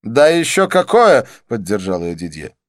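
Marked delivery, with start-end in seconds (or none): 0.0-1.3 high, loud exclamation of agreement; indignant Didier. A narrator's reading. Didier backs Karla up about the insult, high and indignant. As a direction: chime in high and loud, backing up the complaint.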